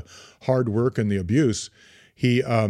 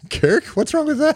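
much higher, laughing put-on Scottish voice on Kirk; laughing Scottish accent; 5 dB louder than usual. Shown as a demonstration. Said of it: "the, I, the accent".

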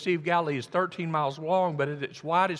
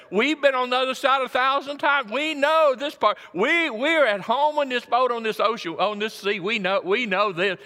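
higher and louder, brash know-it-all boast; cocky disciples; 8 semitones higher, 6 dB louder than usual. Retold or imitated. Preacher voicing the disciples, sure of their own boat, in a loud brash boast. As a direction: puff up like sailors who've seen it all.

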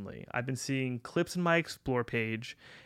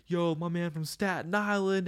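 band hyping the crowd; 5 semitones higher than usual. Retold or imitated. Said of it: "And they were like".

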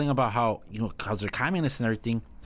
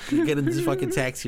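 much higher squeaky imitation; mock squeaky voice; 14 semitones higher than usual. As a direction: mimic the hotel guy in a high squeak. Imitating hotel guy's instruction.

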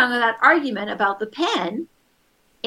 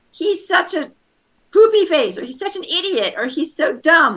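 put-on higher, louder childish insults; mock child calling his brother names; name-calling kid; 7 semitones higher, 4 dB louder than usual. Voicing child's insults about brother.

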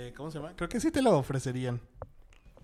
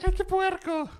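disgusted squeal; slower than usual. Retold or imitated. Imitating disgusted listeners.

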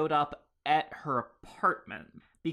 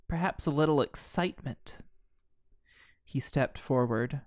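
ordinary talk, nothing marked

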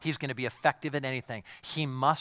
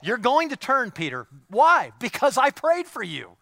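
much higher and louder, ringing promise; triumphant preacher's cry; climactic preaching; 12 semitones higher, 11 dB louder than usual. Acted. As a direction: build to the peak of the sermon.

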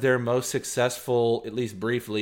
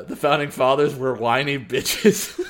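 words broken by laughter, louder; laughing through the line; 4 dB louder than usual. Real, not acted. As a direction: say it while cracking up. Repeating episode title, laughing.

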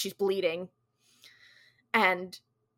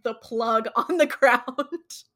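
laughing; 4 semitones higher, 6 dB louder, much slower than usual. Real, not acted. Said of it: "but it's funny".

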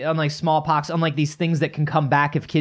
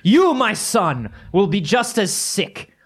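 pompous father; 5 semitones higher than usual. Acted. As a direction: pronounce the family curse proudly to your son. Father lecturing his sick son.

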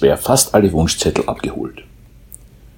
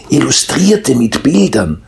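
happy mole; 8 semitones higher, 3 dB louder than usual. A narrator's reading. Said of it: "sagte der glückliche Maulwurf".

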